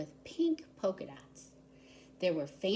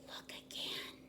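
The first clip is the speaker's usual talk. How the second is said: soft breathy whisper; the mysterious woman whispers once more before vanishing; 10 dB quieter than usual. A narrator's reading. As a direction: lean in and whisper it. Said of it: "she whispered".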